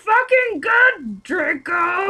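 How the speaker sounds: high, loud, slow hammed-up cry; mock ecstatic scream. Parodying the fanfic climax, slow high shouted cry. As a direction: drag out the fake passion shout. Mock sex scene moan.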